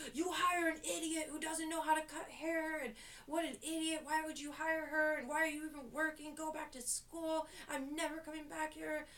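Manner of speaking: high-pitched mock tirade; imitated angry screaming. Angry client berates the manager over her hire, high mock ranting. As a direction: rant like an outraged customer. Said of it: "he was screaming at her".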